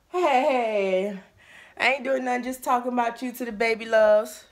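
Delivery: loud reply across the house; calling back. Real, not acted. Daughter shouting back to mom from the kitchen. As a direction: shout back so she can hear.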